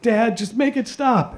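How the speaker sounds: high, slow, frightened plea; pleading child. Retold or imitated. Scared boy begging his dad on the spinning ride, high and slow. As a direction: beg like a terrified little kid. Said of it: "just like".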